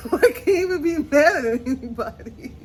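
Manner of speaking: rueful words through a laugh. Real, not acted. Admitting the mess is her own fault, laughing. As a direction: laugh it off at yourself.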